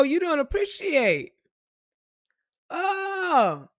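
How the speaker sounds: loud, high mocking oh, okay; hammed-up offended scoff; sarcastic mock outrage. Mocking someone ungrateful, cutting off.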